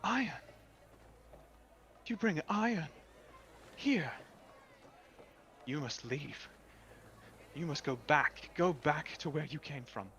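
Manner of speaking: high, loud alarmed fairy lady; alarmed water woman. Acted. Water woman ordering the stranger to leave, high and alarmed. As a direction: recoil from the iron and send him away.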